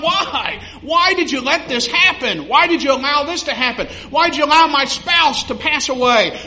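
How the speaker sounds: preacher voicing a grieving believer's why; impassioned preaching. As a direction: cry out the why questions from the pulpit. Preacher voices anguished questions to God.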